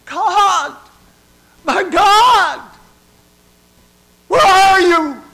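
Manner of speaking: very high, wailing shout; desolate cry. Acted. Jesus alone calls out to God, desolate full-voiced cry. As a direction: scream it out, forsaken, each phrase on its own. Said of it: "Jesus has this desolate cry".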